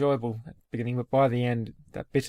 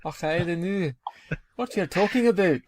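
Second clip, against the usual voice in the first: playful put-on Scottish accent, higher; mock Scottish accent; 6 semitones higher, 3 dB louder than usual. Answering cohost's teasing dare, jokey Scottish accent. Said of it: "You can't do a Scottish accent either".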